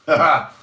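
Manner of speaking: loud, high burst of laughter; teasing laugh. Real, not acted. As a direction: cackle briefly at your own tease. Teasing a viewer about jail.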